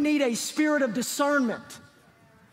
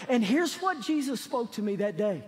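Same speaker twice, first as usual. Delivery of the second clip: ordinary talk, nothing marked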